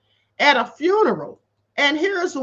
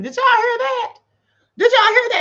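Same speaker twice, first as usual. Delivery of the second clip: shocked disbelief; 10 semitones higher, 5 dB louder than usual. Real, not acted. Appalled by the clip just played.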